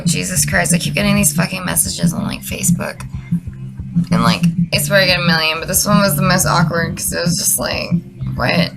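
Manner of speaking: ordinary talk, nothing marked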